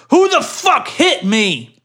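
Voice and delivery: high, slowed shouted question; mock shout. Reading the attacker shouting at the filmer. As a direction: bark it like an angry bloodied man. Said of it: "one of them shouting".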